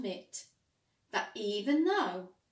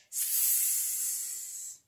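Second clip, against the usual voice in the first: soft unvoiced hiss; snake hiss; 4 dB quieter than usual. Shirley the snake hisses.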